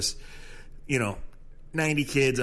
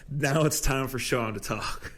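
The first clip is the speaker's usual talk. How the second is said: ordinary delivery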